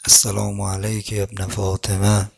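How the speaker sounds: low, measured Arabic recitation; devotional salutation. Real, not acted. Preacher closing the sermon by saluting Imam Hussein, low reverent recitation.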